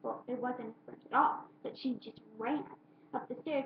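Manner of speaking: usual speaking voice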